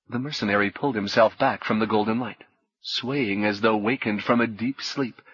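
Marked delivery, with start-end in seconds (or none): none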